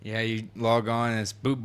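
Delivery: ordinary talk, nothing marked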